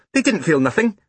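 put-on high child's voice on "nothing"; matter-of-fact small boy. A narrator's reading. Small boy arguing about boiled eggs, "nothing" said high. Boy's ungrammatical line, sister corrects it.

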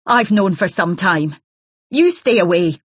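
hostile accusing wife. Acted. Jealous wife warns Isabel off her husband, raw and hostile. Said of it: "a rawness, voice began to be choked".

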